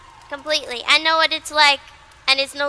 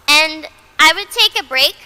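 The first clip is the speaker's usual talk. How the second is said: normal talking voice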